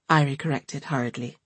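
plain reading voice

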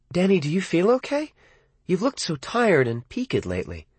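worried mom checking on him; mother's voice. A narrator's reading. Narrator voices his mother.

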